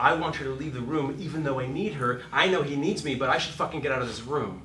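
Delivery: fast, pressed inner voice of a torn character; rushed inner monologue. Shown as a demonstration. Coach voicing a torn character, fast and pressed. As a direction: rattle it off like a conflicted character.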